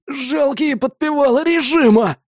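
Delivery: slow, high, strained wheeze of contempt; hoarse croak. A narrator's reading. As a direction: rasp it out like a wounded enemy. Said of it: "прохрипел он".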